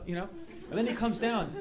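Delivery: ordinary talk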